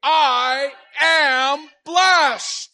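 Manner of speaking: very slow, drawn-out loud call; call for everybody. Shown as a demonstration. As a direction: pull the whole room in. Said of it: "Now how about everybody say".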